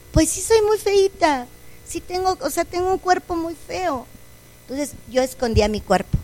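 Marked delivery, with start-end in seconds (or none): 0.1-4.1 put-on a little girl agreeing she's ugly; softly voiced sad child; resigned child. Child accepts being ugly.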